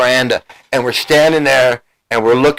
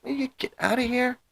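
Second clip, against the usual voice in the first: exasperated wife; 11 semitones higher, 13 dB quieter than usual. Retold or imitated. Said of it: "And she's like".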